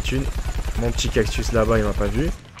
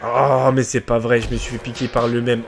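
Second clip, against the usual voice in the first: usual speaking voice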